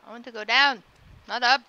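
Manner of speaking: usual speaking voice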